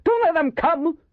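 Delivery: high panicked shout; terrified high-pitched order; desperate cry. A narrator's reading. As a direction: plead loudly with the men behind. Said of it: "Waxy cried over his shoulder".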